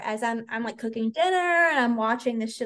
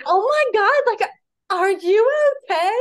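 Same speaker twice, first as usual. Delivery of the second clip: worried friend; 9 semitones higher, 7 dB louder than usual. Retold or imitated. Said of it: "she's like".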